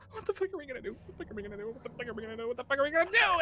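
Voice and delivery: high, frantic looping question; rocking in terror, asking again and again; terrified repeating. Acted. McKenna on her knees beside the others, terrified. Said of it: "she's so beyond terrified".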